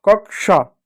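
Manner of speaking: कक्षा said "kak-sha"; कक्षा with k, then sh; k-sh reading. Shown as a demonstration. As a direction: land clearly on sha in कक्षा. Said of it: "श हो जाता है".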